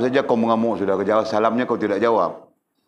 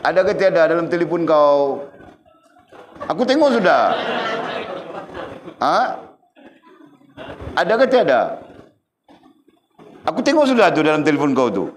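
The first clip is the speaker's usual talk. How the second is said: teasing grilling; 7 semitones higher than usual. Real, not acted. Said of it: "teasing Makil about his phone".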